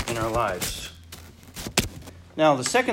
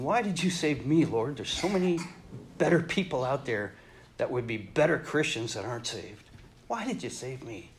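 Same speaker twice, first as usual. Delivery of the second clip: hushed prayer; 3 semitones lower, 7 dB quieter than usual. Retold or imitated. Preacher voices his own prayer.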